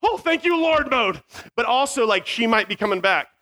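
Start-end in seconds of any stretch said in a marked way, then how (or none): 0.0-0.9 high, fervent thanks to God; a gasp of holy relief; relieved prayer. Retold or imitated. The donkey gone, thanking the Lord, relieved. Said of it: "I'm in, like, mode".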